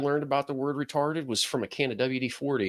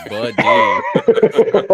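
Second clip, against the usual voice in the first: much higher, louder words cracking into a laugh; 7 dB louder than usual. Real, not acted. Teasing a cohost about a family reunion, laughing. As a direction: squeak it out laughing.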